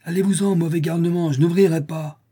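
high-pitched frightened refusal; scared man calling through a locked door; gripped by fear. A narrator's reading. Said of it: "une voix masculine étreinte par la peur".

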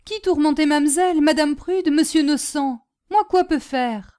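high, loud, halting foreign speech; worried servant in broken French; protective, simple servant. Acted. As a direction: demand who hurt them.

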